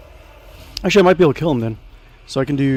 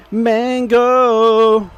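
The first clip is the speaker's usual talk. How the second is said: high, louder call to the kitten; calling the cat; 7 semitones higher, 4 dB louder than usual. Real, not acted. Streamer calls the kitten into the room, high call. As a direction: call the cat's name out, stretched.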